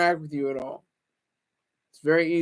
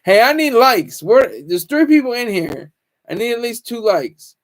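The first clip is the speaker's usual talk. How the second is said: louder, higher call for likes; half-joking plea, pitched up and loud; 4 semitones higher, 9 dB louder than usual. Real, not acted. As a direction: shout it out to the chat. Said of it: "begging viewers for likes".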